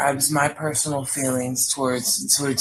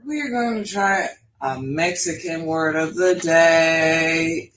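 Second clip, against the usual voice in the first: ordinary delivery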